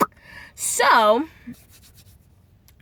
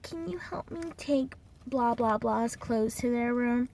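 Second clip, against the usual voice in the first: imitated coworker request; 3 semitones higher, 7 dB quieter than usual. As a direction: mimic a meek little plea for help. Imitates coworker asking for help.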